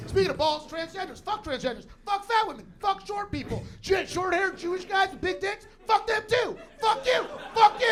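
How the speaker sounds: building from brisk to a full yelled rant; host parodying the comic's set, escalating to shouting; mock rant. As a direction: ramp up into a wild shouting tirade, mocking the last comic. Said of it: "You were like".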